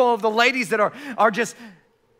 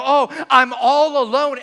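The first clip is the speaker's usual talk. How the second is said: loud, drawn-out, high complaint; hammed-up loneliness, stretched slowly; mock lament; 4 semitones higher, 6 dB louder, slower than usual. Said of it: "where we go".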